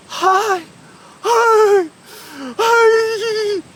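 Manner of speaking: high, loud cries building in panic; wordless yelps of fright, rising and stretched; terrified stammer. Acted. Peter trying to speak as the figure comes, terrified cries. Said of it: "He was terrified".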